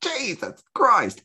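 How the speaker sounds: slow, high, drawn-out exclamation; stunned awe. Retold or imitated. Viewer marvelling at housewives' youthful bodies, stunned awe. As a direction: stretch it out in amazement. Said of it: "And you just think".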